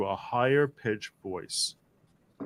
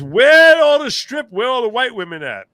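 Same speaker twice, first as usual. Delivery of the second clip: hammed-up movie line read aloud; movie quote voice; 10 semitones higher, 11 dB louder than usual. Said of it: "That's from a movie".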